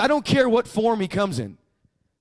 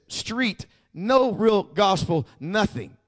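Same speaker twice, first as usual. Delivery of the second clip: usual speaking voice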